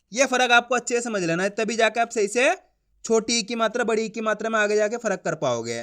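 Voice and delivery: normal talking voice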